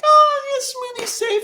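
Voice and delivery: high-pitched mock cooing; exaggerated high-voiced mock reassurance; fussing fatherly dudes. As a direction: imitate doting dads in a high silly voice. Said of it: "being hella fatherly to Smoothie 'cause they're all like".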